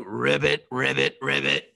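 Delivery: low, lightly voiced ribbits; frog in belly. Teller voicing a frog in the stomach, low croak. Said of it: "they're all going".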